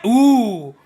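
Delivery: drawn-out excited oohs, the middle one high; rising ooh. Real, not acted. Streamer reacting to the Splatfest intro with rising oohs. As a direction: build up outrage with each ooh.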